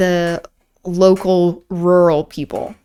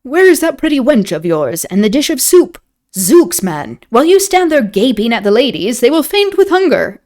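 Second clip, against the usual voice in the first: raised, blustering mock impatience; hearty scolding that climbs higher at the end; jovial lord; 6 semitones higher, 5 dB louder than usual. A narrator's reading. Lord chiding a gaping innkeeper, jovial bluster. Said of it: "Lord Antony's jovial voice".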